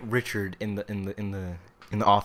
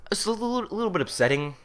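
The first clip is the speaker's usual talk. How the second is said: ironic understatement; 11 semitones higher, 4 dB louder than usual. Real, not acted. Ironic understatement after handcuffs.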